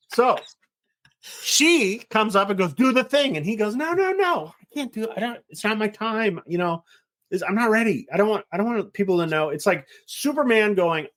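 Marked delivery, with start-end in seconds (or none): 2.7-3.4 urging mom. Retold or imitated. Mom urging her son. 3.7-9.5 higher, whiny protesting son voice; a higher pleading no, no, no; reluctant son. Retold or imitated. Reluctant son refusing his mother.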